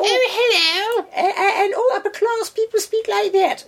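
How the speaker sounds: very high, hammed-up Queen voice; exaggerated falsetto upper-class English accent. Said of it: "It's stereotyping the upper class".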